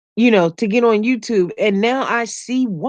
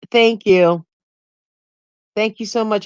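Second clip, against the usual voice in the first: ordinary delivery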